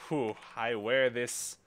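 ordinary delivery

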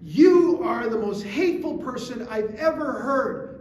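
mock shout, slowing to hammer the accusation; imitated angry shouting. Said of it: "she's shouting at her".

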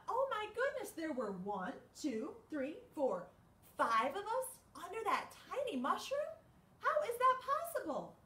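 high, amazed gasp of a voice; squeaky astonished critter voice; surprised animals. Acted. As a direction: sound stunned at the crowd. Animals' surprised thought in story.

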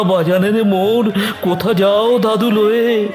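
lightly voiced, pleading little one; child's plaintive question. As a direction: ask it like a child at a funeral. Small child asks where father goes.

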